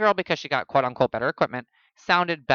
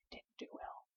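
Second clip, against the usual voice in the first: hushed, whispered confession; whispered aside; 27 dB quieter than usual. Real, not acted. Musician admitting the album flopped, whispered aside. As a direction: whisper it like a secret.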